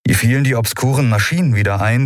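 plain reading voice

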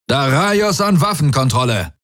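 hoch gerufener Funkspruch; Befehlsruf. A narrator's reading. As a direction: rufe dringend die Waffenkontrolle. Feldherr ruft Befehl ins Komm. (dringender Befehl mit erhobener Stimme)

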